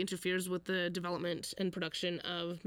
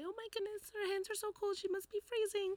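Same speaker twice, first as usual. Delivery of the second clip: high fussing grandmother voice; grandma voice; 12 semitones higher, 4 dB quieter than usual. Retold or imitated. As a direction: coo it like a worried granny. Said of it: "there was my grandma voice".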